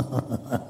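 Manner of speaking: soft, short laugh; wry chuckle. Real, not acted. Preacher laughs at lavish spending on cricket, wry chuckle. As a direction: chuckle in disbelief.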